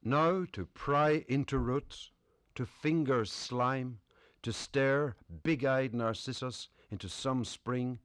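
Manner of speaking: measured poem recitation with weighted phrases; deliberate, lifted poetry-reading voice. Acted. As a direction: recite it line by line, pausing between phrases. Reads own poem to audience.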